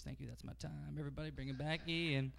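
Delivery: ordinary delivery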